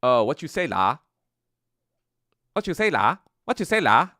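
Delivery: loud, high Singlish caricature; hammed-up Singaporean accent with swooping pitch; mock Singaporean accent. Mocking Singaporean people who talk like that, exaggerated Singlish accent. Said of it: "people who talk like that".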